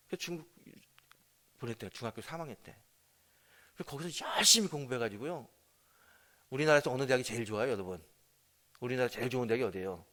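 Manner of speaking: ordinary talk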